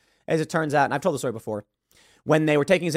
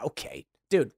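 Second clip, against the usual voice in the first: ordinary delivery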